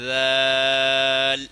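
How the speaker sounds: ذال soft and very slow with tongue at the teeth; soft dhal. Shown as a demonstration. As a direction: ease ذال out gently, drawn long. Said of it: "نرم کر کے پڑھنا ہے".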